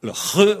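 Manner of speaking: "re" as a loud guttural khe; "re" rasped like Spanish jota; throat-scraped sound. Shown as a demonstration. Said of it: "en vous raclant la gorge".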